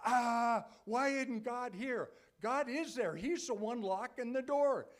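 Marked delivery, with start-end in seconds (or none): 0.1-2.1 loud, high complaint to heaven; exasperated cry. Retold or imitated. Believer complaining to God about a closed door, loud and exasperated. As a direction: throw up your hands and cry out. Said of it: "when we go".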